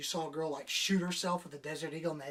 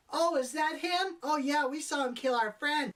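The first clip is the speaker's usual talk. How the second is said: high, louder imitation of eager children; mock kids' voices; 8 semitones higher, 5 dB louder than usual. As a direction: pitch it up like a blithely chattering kid. Voices kids answering the lady.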